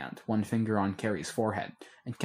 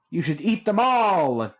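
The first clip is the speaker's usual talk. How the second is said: put-on loud, slow, taunting kid's jeer; jeering shout; 8 semitones higher, 8 dB louder, slower than usual. A narrator's reading. Boy taunting his brother with burritos, loud jeer. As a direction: jeer it at him, loud and drawn out. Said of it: "Declan Two jeered".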